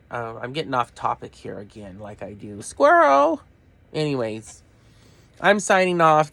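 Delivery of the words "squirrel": sudden high shout of distraction; squirrel outburst. Pretend. Vlogger mocking her own wandering mind, sudden loud yelp. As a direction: blurt it like a distracted dog. Joke about getting off topic.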